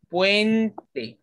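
"puente" with ue together, pause, te; syllable by syllable. Shown as a demonstration. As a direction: split "puente" into two slow beats. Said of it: "U E".

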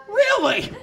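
high-pitched, drawn-out disbelief; incredulous. Acted. Replying to someone who hates kids, incredulous. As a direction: question it as if appalled.

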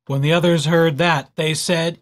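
ordinary delivery

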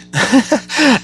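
a short, high burst of real laughter. Real, not acted. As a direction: laugh at your own confession. Laughs at own greed answer.

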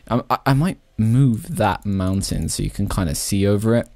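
normal talking voice

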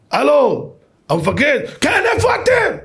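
high-pitched calling down the line; urgent phone call. Retold or imitated. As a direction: act out a frantic field call. Acted urgent field phone call. (קריאה דחופה וגבוהה בטלפון)

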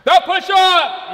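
drawn-out drill sergeant's call. Shown as a demonstration. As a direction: call out the exercise slowly, like a drill leader. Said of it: "I would say".

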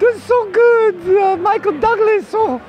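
high-pitched, drawn-out impersonation; slow, strained-high celebrity impression. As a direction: keep up the exaggerated high actor voice. Stays in the impression voice.

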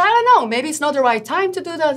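mock the dithering buyer; whiny client. Client voiced on phone.

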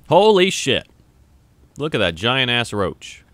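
normal talking voice

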